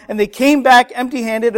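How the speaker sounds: usual speaking voice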